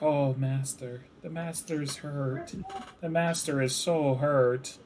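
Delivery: soft, slow, worried creature voice; fretful kobold murmur. Acted. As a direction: coo over the hurt master. Kobolds fuss over master.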